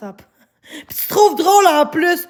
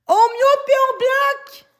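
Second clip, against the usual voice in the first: high, slow, strained shout; shouting order; 8 semitones higher, slower than usual. Real, not acted. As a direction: yell it once more at the mods. Repeats the order, escalating.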